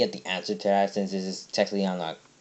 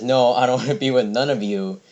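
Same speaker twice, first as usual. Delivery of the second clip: mock protest, louder with a laugh; retold loud refusal; 3 semitones higher, 7 dB louder than usual. Greg turns down all the humans, loud mock refusal. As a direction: push back loudly, half laughing as you retell it.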